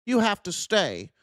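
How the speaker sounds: high, sneering parody of a flag-waver; mocking nationalist quote. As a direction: mimic the my-country-or-leave crowd, scornfully. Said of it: "a dumb fucking thing to say".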